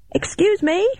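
high-pitched call for attention; yelled. A narrator's reading. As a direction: hail a stranger in a high voice. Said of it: "I yelled".

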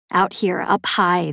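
plain reading voice

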